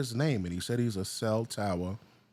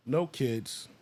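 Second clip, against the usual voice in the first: ordinary delivery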